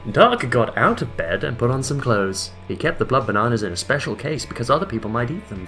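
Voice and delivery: ordinary talk, nothing marked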